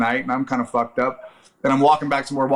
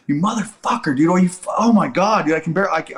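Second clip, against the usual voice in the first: retold pained cursing; 5 semitones higher than usual. Cursing friend after eardrum puncture.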